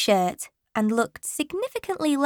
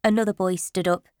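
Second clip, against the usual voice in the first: plain reading voice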